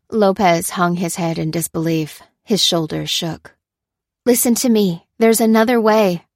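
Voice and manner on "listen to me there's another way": high, loud, insistent appeal; urging. A narrator's reading. Lucy offers the despairing prisoner another way, urging. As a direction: press the point urgently. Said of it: "urged Lucy".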